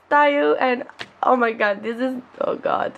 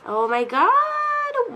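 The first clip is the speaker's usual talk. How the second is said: much higher and slow, stretched oh my god; drawn-out squeal; 14 semitones higher, much slower than usual. Real, not acted. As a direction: stretch it out in delighted disbelief. Drawn out in amazement.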